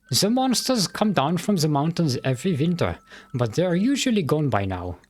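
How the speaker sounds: put-on the sheriff in a mild German accent. Sheriff telling the adventurer about the town, light German accent. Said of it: "me trying to do a German accent".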